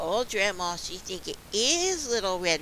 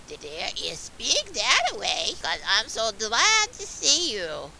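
raised, lightly acted wolf reply; a hint of put-on granny; wolf posing as grandma; 4 semitones higher, 5 dB louder than usual. A narrator's reading. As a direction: answer sweet-talking as the fake grandma. Wolf answers as fake grandma.

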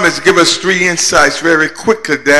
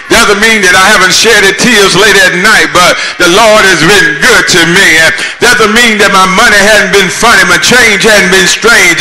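preaching at full force; 4 dB louder, faster than usual. Acted. Preacher rousing the congregation with praise, building to a fervent shout. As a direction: build the chant until they shout back.

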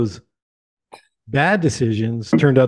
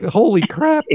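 high-pitched mock-shocked reaction; playfully appalled, voice jumping up; 9 semitones higher than usual. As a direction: act taken aback, pitched up. Acted reaction to swearing Australians.

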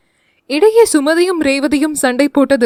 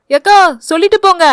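higher, louder, quick call after her; 6 semitones higher, 5 dB louder, faster than usual. Acted. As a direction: call her back as she walks off. Boy calling after her. (பின்னால் கூப்பிடும் குரல்)

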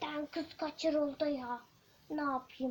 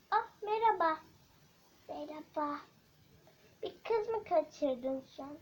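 normal talking voice

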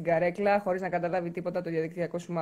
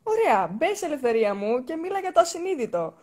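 high, excited pleading; excited coaxing; 7 semitones higher, 4 dB louder than usual. Acted. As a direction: coax her in with a high, eager voice.

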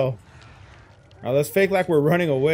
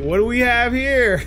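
higher, louder delighted tease; excited discovery; 10 semitones higher, 5 dB louder than usual. Real, not acted. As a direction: pounce on the find. Killer spots survivor.

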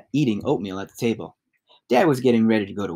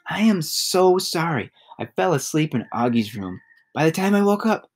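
remorseful; 6 semitones higher than usual. A narrator's reading. Mother apologizing to daughter.

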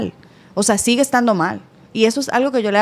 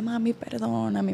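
daughter's apology; 9 dB quieter, slower than usual. Retold or imitated. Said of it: "ella siempre viene llorando".

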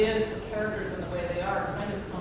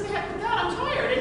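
grumpy traveller; 12 semitones higher than usual. Retold or imitated. Voicing the traveller in a folktale.

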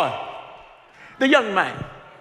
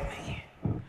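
whispered sigh; 19 dB quieter than usual. Retold or imitated. Restless young man sighs.

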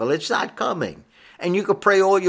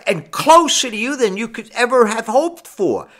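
high, rolling pulpit cadence; fervent preaching; 8 semitones higher than usual. Real, not acted. Preacher extols where fear of God leads, fervent preaching. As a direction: let each "more and more" swell in waves.

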